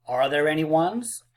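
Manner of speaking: normal talking voice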